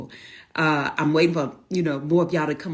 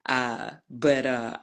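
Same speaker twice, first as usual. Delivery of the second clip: ordinary talk, nothing marked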